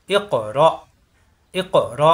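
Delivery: এ ক bounced, র stopped in the throat; bounce, then catch. Shown as a demonstration. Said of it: "এখানে বাড়ি খাবে".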